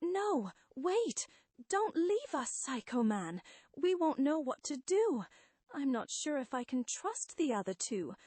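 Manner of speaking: high-pitched, desperate begging; frightened pleading. Acted. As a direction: plead as if crippled by doubt. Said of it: "reduced to quivering, pleading, pitiful creatures of doubt".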